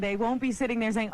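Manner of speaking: ordinary talk, nothing marked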